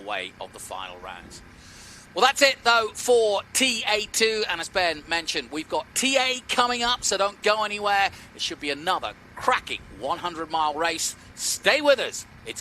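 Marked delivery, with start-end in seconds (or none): 2.1-12.3 loud, high broadcast sign-off; excited sign-off. Acted. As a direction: pump it up for the next race. Booth commentator signing off.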